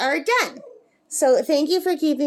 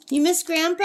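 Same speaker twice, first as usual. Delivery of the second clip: higher-pitched pet-talk voice; talking sweetly up high to a pet bird; coaxing a pet; 6 semitones higher than usual. Real, not acted. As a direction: coax the bird in a high, sing-song lilt. Asking her pet bird.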